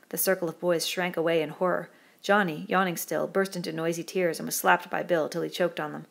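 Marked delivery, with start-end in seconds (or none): none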